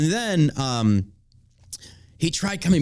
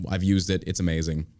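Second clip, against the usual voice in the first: ordinary delivery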